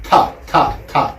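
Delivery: repeated "ta" tapped on the palate; crisp "ta, ta, ta" from the roof of the mouth; palatal ta. Shown as a demonstration. Teacher drilling the palatal sound for the class. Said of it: "comes from the top of your mouth".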